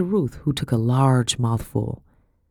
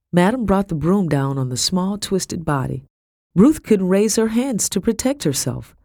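ordinary delivery

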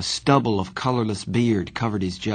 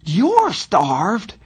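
high-pitched, incredulous indignation; almost squeak with outrage; indignant near-squeak; 10 semitones higher, 4 dB louder than usual. A narrator's reading. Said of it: "so indignant he nearly squeaked".

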